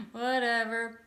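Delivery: slow, dreamy and drifting; woozy post-seizure drawl; spaced-out and floaty; loopy. Retold or imitated. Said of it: "starting to get a little bit loopy".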